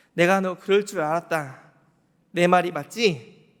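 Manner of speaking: knowing told-you-so. Pretend. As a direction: say it loud as Jesus who knew all along. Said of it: "이런 눈빛이었을까요?". (다 알았다는 듯 크게 말하는 예수님)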